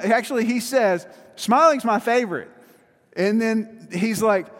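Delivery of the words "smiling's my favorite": happy Buddy. Retold or imitated. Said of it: "he's the happiest guy you'll ever meet".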